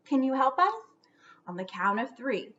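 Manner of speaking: usual speaking voice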